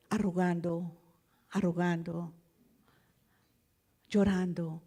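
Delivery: low, soft, with long pauses; hushed, heavy-hearted telling; subdued sorrow. Real, not acted. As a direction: let each word sink, quietly pained. Recounts her heart shrinking in pain.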